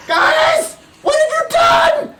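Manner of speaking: very high, loud acted scream; screaming girl. Girl running at the group, screaming and yelling. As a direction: shriek it like a panicked girl. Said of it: "girl screaming and yelling and going".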